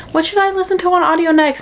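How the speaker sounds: light voice for the friend; quoting friend. Retold or imitated. Quoting best friend's message.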